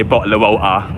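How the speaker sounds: East London "bottle of water" with dropped t's; glottal-stop East London "bottle of water"; East London accent. Shown as a demonstration. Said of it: "I don't say".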